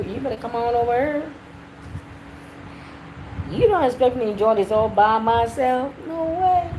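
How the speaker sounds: put-on playful Southern US accent. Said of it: "You like my southern accent?".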